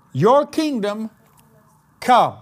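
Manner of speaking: ringing out Your kingdom come, loud; loud declaration. Acted. Preacher to congregation, loud declaration. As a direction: proclaim it like a decree.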